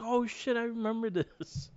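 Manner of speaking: high-pitched jolt of remembering; sudden recognition. Retold or imitated. Wrestling fan recalls seeing the match start, excited recognition. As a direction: relive the moment it all came back.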